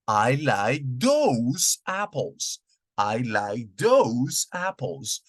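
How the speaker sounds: "I like those apples" said slowly, falling on "those"; slow model sentence. Shown as a demonstration. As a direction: stress "those" in a slow teaching read. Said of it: "Un ejemplo sería".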